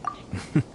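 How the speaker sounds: a short, quiet amused laugh under the breath; soft chuckle. Acted. Commissioner chuckling quietly about the dango milk, soft laugh. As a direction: let a little laugh slip out softly.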